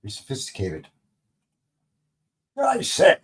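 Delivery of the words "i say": much higher, posh British exclamation; a plummy British toff's I say; posh British accent. Pretend. Podcaster pretending to be a sophisticated gentleman, posh British accent. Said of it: "I always have to have a British accent when it's somebody that's sophisticated".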